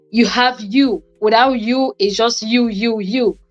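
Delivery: ordinary delivery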